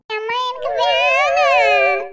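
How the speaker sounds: loud, long-drawn wail; child's whining refusal. Acted. Child resisting mother's order to take ice cream, wailing. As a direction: stretch the refusal into a bawl.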